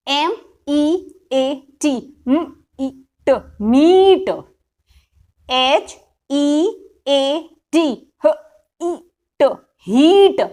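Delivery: slow, letter-by-letter spelling drill, each sound drawn out; phonics sound drill. Shown as a demonstration. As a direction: spell it out sound by sound for a class of kids. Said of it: "ये sound drill जो है, जोर से बच्चे को पढ़ानी है". (धीरे-धीरे अक्षर-अक्षर बोलकर साउंड ड्रिल)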